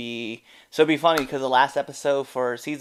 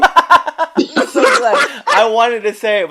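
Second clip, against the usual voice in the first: laughing while talking; words through laughter; 10 semitones higher, 12 dB louder, slower than usual. Real, not acted. Cracks up at chat joke.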